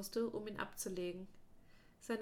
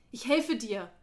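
loud, urgent insistence; insistent call; 9 dB louder than usual. Acted. Darren insisting he will save his friend, loud. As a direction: push it out louder, determined.

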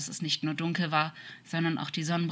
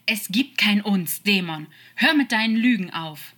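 loud, higher furious denial; forceful raised-voice outburst; angry shout; 5 semitones higher, 9 dB louder than usual. Acted. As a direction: lash out to silence a liar. Angel shouts down the demon.

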